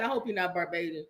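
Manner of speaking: low, quiet worried murmur; hushed mock worry. A West Indian hearing the news, quiet and low in mock worry. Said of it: "He's West Indian".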